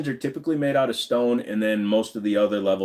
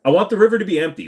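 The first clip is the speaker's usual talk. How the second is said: excited rant; 8 semitones higher, 6 dB louder, faster than usual. Real, not acted. Joking rant about Melissa McCarthy.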